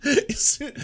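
high breathy laugh into words. Real, not acted. Laughing to viewers about Drill's feud, high laugh. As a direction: laugh as you start the sentence.

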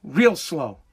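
ordinary talk, nothing marked

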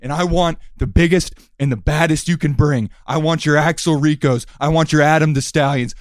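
loud, menacing heel promo; wrestling promo. Acted. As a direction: cut a threatening promo.

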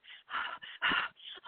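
long breathy sigh of annoyance; weary huff; exasperated sigh. Retold or imitated. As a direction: sigh out your frustration. Exasperated sigh before order.

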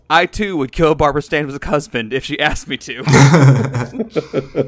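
reading through laughter; giggling as she reads the quote; laughing while reading. Retold or imitated.

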